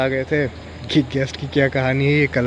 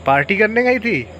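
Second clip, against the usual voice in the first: put-on higher, teasing Bengali-accented question; Bengali accent in Hindi; 9 semitones higher than usual. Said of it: "लेकिन Bengali accent में".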